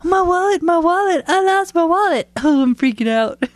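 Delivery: high-pitched kid mimicking mom's panic; mocking child's impression. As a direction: wail it in hammed-up alarm, making fun. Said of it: "Making fun of me".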